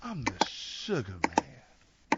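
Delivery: low, soft, very drawn-out tagline; Sugar Man's deep voice. Acted. Robinson caps his denial with his nickname, deep voice. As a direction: drop deep and stretch every word. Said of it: "Like his voice got deeper".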